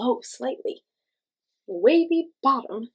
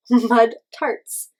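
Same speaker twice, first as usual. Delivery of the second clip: bursting into a laugh, much louder; 2 semitones higher, 11 dB louder than usual. Real, not acted. Reading gross ghost snacks to kids, laughing loudly. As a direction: laugh out the words.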